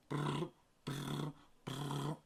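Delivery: high buzzing alarm imitation; alarm noise. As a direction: mimic a blaring siren.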